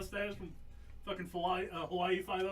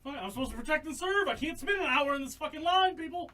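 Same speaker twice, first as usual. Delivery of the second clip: entitled cop rant; 7 semitones higher than usual. Pretend. Pretend cop complaining loudly.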